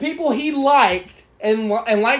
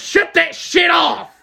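mock shout; 7 semitones higher, much slower than usual. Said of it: "And he was like".

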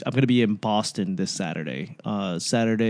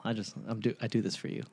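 quiet aside; 13 dB quieter, faster than usual. Real, not acted. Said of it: "He likes to mumble".

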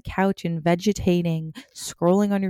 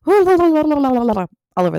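loud; 8 semitones higher, 9 dB louder, much slower than usual. Acted. Emphatic podcast sign-off point.

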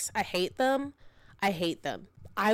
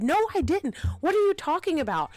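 higher, quick retold protest; imitated husband, pitched up and emphatic; indignant denial; 9 semitones higher, 4 dB louder, faster than usual. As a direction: argue back in mock exasperation. Acted quarrel, husband denies it.

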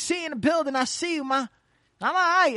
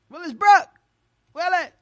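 louder, higher call of the name; 6 semitones higher, 7 dB louder than usual. Real, not acted. Host calling a silent caller, loud call-out. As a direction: call him down the phone line.

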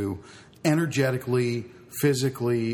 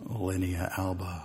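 slow and low, like saying something sexy; slow sultry medical talk; 4 semitones lower, 6 dB quieter, slower than usual. Pretend. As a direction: linea alba said slowly and seductively. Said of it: "I love when you talk medical".

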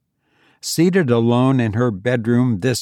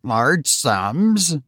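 exasperated; 4 semitones higher than usual. A narrator's reading. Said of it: "repeated the exasperated Tabitha".

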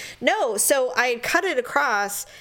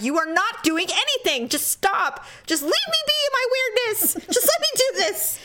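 rising higher into mock wailing; retold exasperation; 11 semitones higher than usual. Retelling her refusal of help, exasperated rising pitch. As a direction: build from firm protest to high plea. Retold pleading to be left alone.

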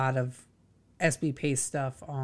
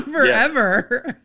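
laughing; 9 semitones higher, 10 dB louder, much slower than usual. Real, not acted. Laughs at her own naivety.

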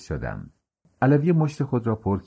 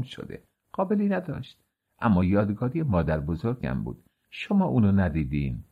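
put-on soft, hushed old-nanny lament; subdued, sorrowful voice, softer and uneven in pitch; plaintive Naneh Habib; 2 semitones lower, 4 dB quieter than usual. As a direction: confide a loss, gently fishing for an answer.

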